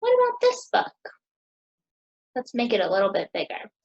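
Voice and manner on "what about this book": curious excitement. Real, not acted. Teacher showing children a new book.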